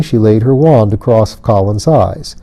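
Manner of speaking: ordinary delivery, nothing marked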